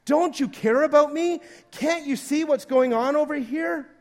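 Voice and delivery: high, loud, desperate pleading; panicked demand. Acted. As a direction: demand help as if drowning. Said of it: "we command that he wake up".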